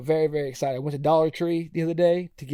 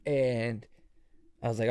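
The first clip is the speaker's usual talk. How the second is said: ordinary talk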